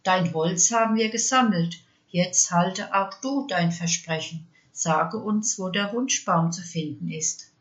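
ordinary delivery, nothing marked

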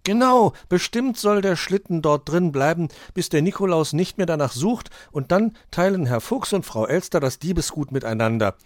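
high, loud little detective; Borstel, sure of the theory. Acted. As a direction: jump in with keen, certain agreement.

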